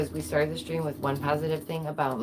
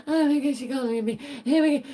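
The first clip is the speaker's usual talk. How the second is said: high, loud mock outrage; mock upset; 8 semitones higher, 5 dB louder, faster than usual. Streamer mocking people offended at being called beta, mock upset. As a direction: mimic an offended whiner up high. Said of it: "Some people get really upset about it".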